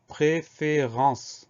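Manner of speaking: "préférence" slowly split as pré-fé-ren-ce; syllable by syllable. Shown as a demonstration. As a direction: dictate "préférence" one syllable at a time. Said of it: "Donc on a quatre syllabes".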